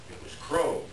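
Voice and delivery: plain reading voice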